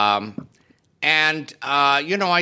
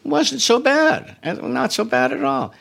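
inner voice; 11 semitones higher than usual. Retold or imitated. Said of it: "I say to myself".

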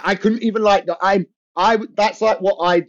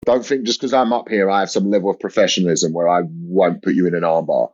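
low, blunt, half-joking menace; mock threat; 7 semitones lower than usual. Comedian threatening a heckler, low mock menace. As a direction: warn the heckler off, deadpan low.

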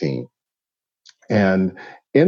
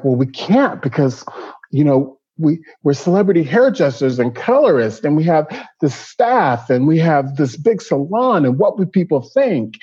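flustered excuses; 9 semitones higher than usual. Retold or imitated. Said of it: "And we were like".

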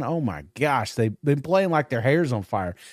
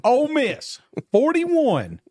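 excited; 12 semitones higher, slower than usual. Real, not acted. Announcing his lock pick.

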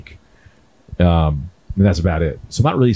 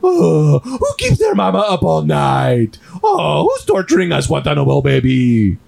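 put-on high, slow cooing to a baby; baby talk; 4 semitones higher, slower than usual. Shown as a demonstration. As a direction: coo through exasperation, sing-song and drawn out. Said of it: "Baby talk is not for the baby".

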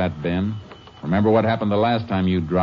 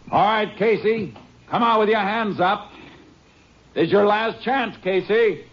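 slow, raised commanding call, pitched high; 14 semitones higher than usual. Acted. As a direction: call him out with steady authority. Calling to hidden gunman.

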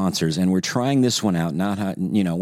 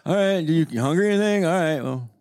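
laid-back grandparent; 8 semitones higher than usual. Retold or imitated. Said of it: "We're just like".

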